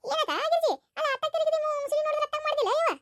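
a nosy neighbour fussing over the injury; gossipy neighbour woman. Acted. Neighbour asks daughter-in-law about her leg, high chatty voice.

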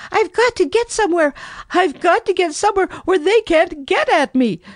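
high, fast, sobbing mouse voice; a tiny mouse sobbing in panic, hurried; sobbing frightened mouse. A narrator's reading. Mouse trapped in his tunnel, sobbing high and fast. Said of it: "he sobbed".

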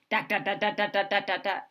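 hammered, steady da da da; jackhammer noise. A narrator's reading. Imitating a jackhammer in the street for a child. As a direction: bang out each da like a drill. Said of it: "loud banging sound".

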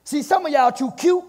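loud, high, driving sermon delivery; full-voiced preacher in full swing; fervent preaching. Real, not acted. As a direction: preach it with rising force.

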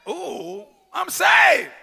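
high, loud joyful cry; excited shout. Retold or imitated. New believer bragging about being saved, loud. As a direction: shout it like fresh good news.

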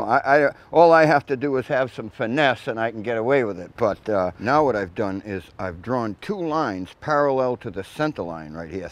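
usual speaking voice

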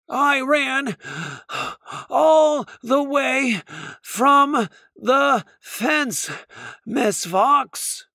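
high, slow, gasping croak after running; croaking, out of breath. Acted. Squirrel to Miss Fox after running from the fence, a high, slow croak. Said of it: "Squirrel croaked".

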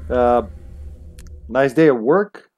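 ordinary delivery

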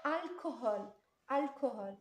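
"alcohol" with a clear hard k in the middle; k sound. Shown as a demonstration. Teacher modelling the k sound for pupils, "alcohol" with hard k. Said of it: "ك صوت".

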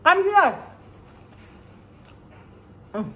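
normal talking voice

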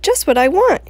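cried out. Acted. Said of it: "he cried".

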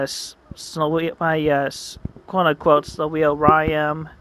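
ordinary delivery, nothing marked

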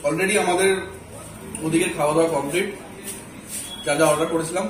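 usual speaking voice

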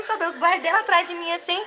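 normal talking voice

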